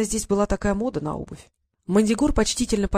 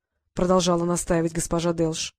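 ordinary delivery, nothing marked

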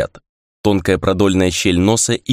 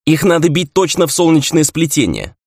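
higher, urgent call; shouted instruction; 7 semitones higher, 3 dB louder than usual. A narrator's reading. Ray telling fighters where to strike, shouted. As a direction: call out the order, pitch raised. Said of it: "крикнул в ответ Рэй".

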